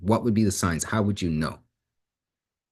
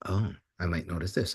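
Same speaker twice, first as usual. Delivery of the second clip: quiet realisation; 7 dB quieter than usual. Retold or imitated. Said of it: "gets them to start thinking".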